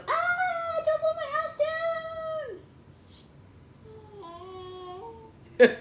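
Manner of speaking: very high squealed piggy plea; screaming piggy. Shown as a demonstration. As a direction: wail it as a tiny storybook pig. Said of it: "Does the piggy go".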